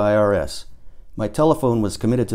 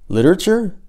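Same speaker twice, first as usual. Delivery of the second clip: surprised echo; 9 semitones higher, 4 dB louder than usual. A narrator's reading. Narrator's sudden realisation.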